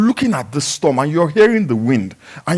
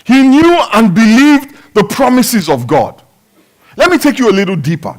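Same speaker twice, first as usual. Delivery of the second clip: loud, high preaching shout; preaching climax; 7 semitones higher, 5 dB louder than usual. Acted. Pastor to congregation, loud preaching climax. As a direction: drive it home to the church.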